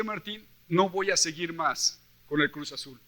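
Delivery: ordinary talk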